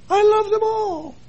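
very high, put-on sing-out; hammed-up high-pitched declaration of love; exaggerated, held-high voice of an insincere churchgoer; mock gushing claim. Mock reply of a church member.

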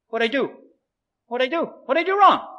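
high-pitched innocent appeal; mock-innocent, rising in pitch; bewildered protest. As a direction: play the baffled son asking mom. Student bewildered, appealing to upset mother.

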